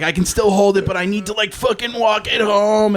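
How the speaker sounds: high, strained mock desperation; retold panic. Retold panic before walking home.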